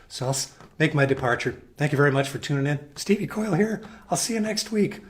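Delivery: hoarse voice giving out; strained, worn-out voice; losing voice. Real, not acted. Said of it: "I'm losing my voice swiftly".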